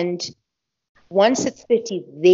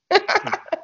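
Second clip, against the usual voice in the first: high giggle; 13 semitones higher than usual. Real, not acted. Laughing at her own crying.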